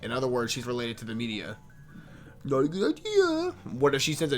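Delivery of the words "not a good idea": put-on way up high, girlish; high girl's voice. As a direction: switch into a high female voice. Player voices a female character.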